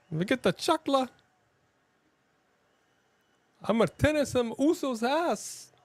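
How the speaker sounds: high, far-off mimicking voice; high imitating voice. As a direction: do a high pitched impression.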